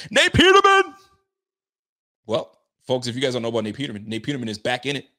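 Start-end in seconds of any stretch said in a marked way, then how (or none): 0.1-1.0 high, loud shouted impression of Rico. Calling out Nate Peterman in a Rico voice, loud and high. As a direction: belt the name like an excited Rico. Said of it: "in my Rico voice".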